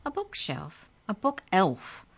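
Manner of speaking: high squeaky shriek, rising on "book elf". Acted. The book elf shrieks a correction at Trent. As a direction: correct the dumb boy. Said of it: "the creature shrieked".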